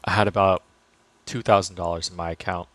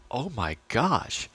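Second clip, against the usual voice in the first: mock shock; 6 dB quieter, slower than usual. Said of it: "a major gut check".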